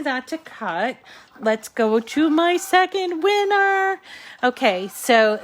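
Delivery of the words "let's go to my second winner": high, rising singsong announcement; playful announcement. Real, not acted. Host announces the second winner to viewers, playful singsong. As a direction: sing out the prize news on a climbing pitch.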